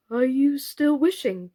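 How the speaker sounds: high, worried question; anxious. A narrator's reading. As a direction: ask with a nervous catch. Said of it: "asked Mister Plantagenet anxiously".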